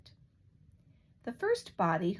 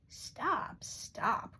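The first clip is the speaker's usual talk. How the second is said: plain reading voice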